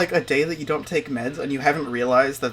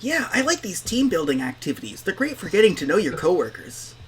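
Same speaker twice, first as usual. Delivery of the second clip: higher-pitched polite small talk; put-on higher, over-polite voice; forced pleasantry; 6 semitones higher than usual. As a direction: chirp an awkward coworker pleasantry. Acted forced small talk in character.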